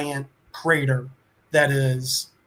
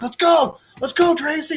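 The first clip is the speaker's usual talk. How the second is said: high, loud hurried call to his wife; 10 semitones higher, 4 dB louder than usual. Retold or imitated. Man calls out to his wife as the missiles come: loud, urgent hurry. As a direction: hustle her along, shouting her name. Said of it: "he's calling to his wife".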